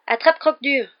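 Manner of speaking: shouted urgent command; shouting. A narrator's reading. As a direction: yell it as you dive for the car. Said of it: "hurla Harry".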